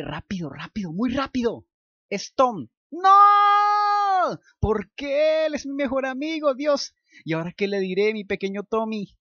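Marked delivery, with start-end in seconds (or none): 2.1-9.2 shrill, very loud, high cry of No; horrified panic. Acted. Luke realizing he named his best friend, screaming in panic. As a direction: recoil in horror at your own answer.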